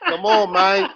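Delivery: high pleading call of a name; Terrence Howard impression. Shown as a demonstration. Host showing off his Terrence Howard voice, high and pleading. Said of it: "This is my Terrence Howard voice".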